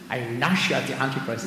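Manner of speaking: a light hint of a Northern Irish accent; mild imitation of a Northern Irish preacher. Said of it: "I'm tempted to do the Northern Irish accent".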